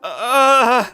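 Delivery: loud, high, drawn-out "uh"; loud wail of embarrassment; flustered groan. Acted. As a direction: cry out, stuck for an answer. Flustered by question about the date.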